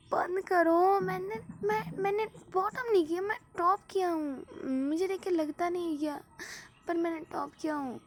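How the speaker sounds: high, whiny insistence, close to tears; crybaby protest. A narrator's reading. V insists to teasing friends, high whining.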